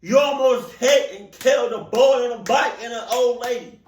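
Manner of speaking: loud, high indignant rant; outraged. Real, not acted. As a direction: call out the reckless driver. Outraged at the driver.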